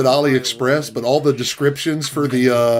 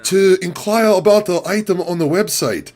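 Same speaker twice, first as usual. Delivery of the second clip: higher, mock Chinese-accented English operator, eager to please; AliExpress operator in broken English; 5 semitones higher than usual. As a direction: play the overly polite AliExpress phone operator with a put-on Chinese accent. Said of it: "my impression of the operator".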